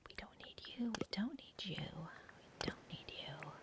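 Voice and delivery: soft teasing whisper. Real, not acted. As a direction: whisper it to the junk. Discarding items, whispered.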